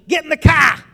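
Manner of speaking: high, very loud yell with a Massachusetts twang; mom's warning yell. Retold or imitated. Mom calling the kids to the car, loud warning. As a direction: yell it like the last warning before a spanking. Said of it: "her voice would change. And when it sounded funny".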